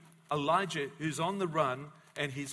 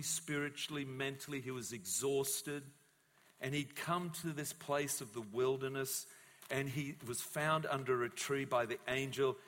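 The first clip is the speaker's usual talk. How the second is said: ordinary delivery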